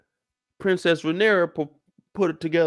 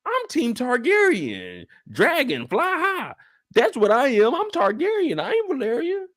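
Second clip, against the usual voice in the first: high, proud team chant; high rallying cry; fan cheer; 8 semitones higher than usual. Acted. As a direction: cheer for your side in a raised high voice. Cheering declaration.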